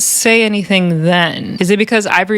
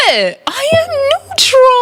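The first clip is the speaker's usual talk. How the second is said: very high, drawn-out mock protest; mock indignation; much slower than usual. Recapper mimicking Natalie told to stay neutral, mock indignation. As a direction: squeal it slowly in imitation of indignant denial.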